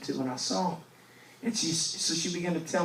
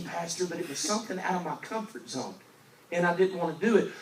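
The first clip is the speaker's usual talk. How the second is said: ordinary talk, nothing marked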